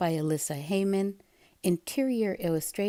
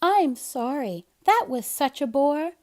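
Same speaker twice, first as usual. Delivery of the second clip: higher, brighter, rising eagerly; Kitten's voice; 5 semitones higher, 4 dB louder than usual. Acted. Kitten offering Seymour something better, eager. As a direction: chirp with a new idea. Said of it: "said Kitten".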